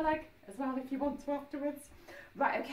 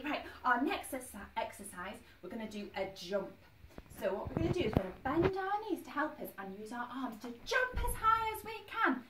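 ordinary talk, nothing marked

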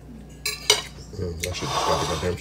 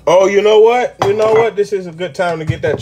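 excited; 11 semitones higher, 9 dB louder than usual. Real, not acted. Sudden idea for rum.

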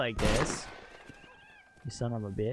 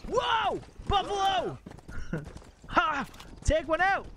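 excited shout; 13 semitones higher than usual. Real, not acted. Streamer spotting buffalo in the game, excited high shout. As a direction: yell it like spotting big game. Spots buffalo while hunting.

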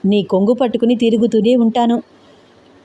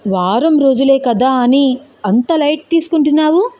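higher-pitched protest rising into a question; indignant echo; 4 semitones higher than usual. Acted. Husband protests at wife's casual week-long wait, indignant and rising. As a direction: throw the words back in disbelief. Husband throws her words back.

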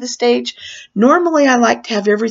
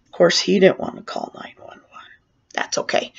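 hushed wry whisper to the audience, much softer; whispered aside; 3 semitones lower, 6 dB quieter than usual. Real, not acted. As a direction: drop to a whisper as if letting them in on it.

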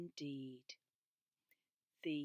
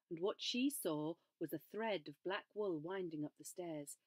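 ordinary delivery

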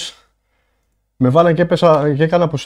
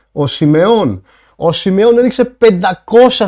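real shock, raised pitch and loud; stunned amazement; 4 semitones higher, 4 dB louder than usual. Real, not acted. Streamer to viewers on a huge donation, astonished. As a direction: exclaim in disbelief at a huge surprise.

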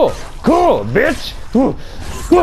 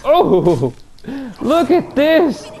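taunting; 7 semitones higher, slower than usual. Acted. Taunting enemy in fight.

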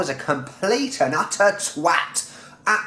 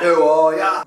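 loud mock lad chant; drunken English lad; 6 dB louder than usual. Introducing the drunken English lad, rowdy and loud. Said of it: "a drunken pissing English lad".

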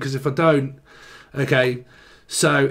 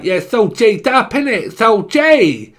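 higher, louder slang, bruv and innit; lingoed-up roadman; 7 semitones higher, 6 dB louder than usual. Retold or imitated. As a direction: put on full street lingo. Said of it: "Why do people talk like that?".

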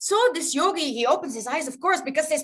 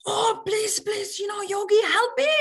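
high, urgent begging; pleading cat; 5 semitones higher than usual. Acted. Cat chased by a tiger begs the yogi, pleading. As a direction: beg for help, a little hammed-up.

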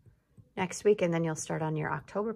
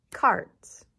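loud snapped word; 8 dB louder than usual. Shown as a demonstration.

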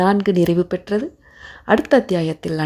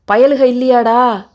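raised, louder questioning; interrogating policeman; 5 semitones higher, 5 dB louder than usual. A narrator's reading. Policeman grills the keeper about boys, demanding. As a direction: press him for an answer.

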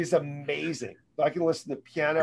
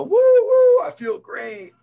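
a high, loud, whooping burst of pumped-up joy; over-the-top seminar cheer, cranked way up; Tony Robbins-style hype; 10 dB louder, much slower than usual. Retold or imitated. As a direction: whoop it out like a motivational guru. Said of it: "kinda Tony Robbins-ish".

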